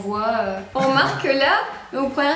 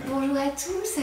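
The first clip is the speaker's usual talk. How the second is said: honeyed voice; 3 semitones higher, 6 dB quieter, slower than usual. Retold or imitated. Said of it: "on parle toutes avec une voix mielleuse".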